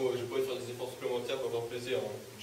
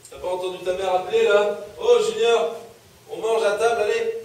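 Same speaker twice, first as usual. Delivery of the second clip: loud, high-pitched scolding call; stern shouting; 7 semitones higher, 11 dB louder than usual. Acted. Father summons Junior to eat at table, shouting sternly. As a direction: yell for someone who ignored the first call.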